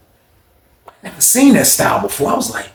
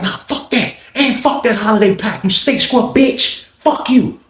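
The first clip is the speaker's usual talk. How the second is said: drawn-out pitched-up cussing; high, slow mock insult; 6 semitones higher, slower than usual. [name] insulting Lawrence, high and slow. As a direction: spit it slowly, pitched high.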